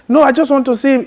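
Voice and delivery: high, pushy imitation of the professor; mock insistent professor. The professor insisting on seeing him, in a high mock voice. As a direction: chirp it up high like a stubborn teacher.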